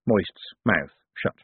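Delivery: ordinary delivery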